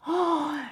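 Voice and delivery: soft, high gasp of admiration; delighted gasp. Real, not acted. Vlogger admiring the grain leather, delighted gasp. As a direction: take a quiet, thrilled gasp.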